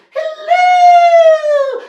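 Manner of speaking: very high, loud, hammed-up hoot; queen's cry. A narrator's reading. Queen greeting the children, high loud cry. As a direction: trill it out like a grand queen. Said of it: "Oh, the queen!".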